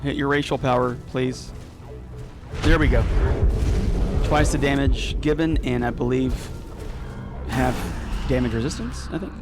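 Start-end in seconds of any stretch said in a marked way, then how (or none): none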